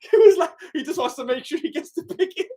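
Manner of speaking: high, laughing through the words. Real, not acted. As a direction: laugh as you explain. Laughing at the news.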